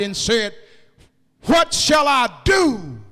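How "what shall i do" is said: jailer's desperate cry. Acted. Jailer cries out, preacher voicing him.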